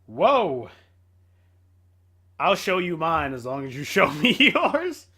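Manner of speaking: ordinary talk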